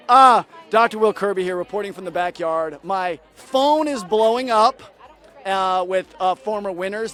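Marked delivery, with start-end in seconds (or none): 0.1-2.8 a hammed-up live reporter's sign-off; a mock news sign-off from the backyard. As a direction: announce yourself like a field reporter on air.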